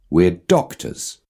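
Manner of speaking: ordinary talk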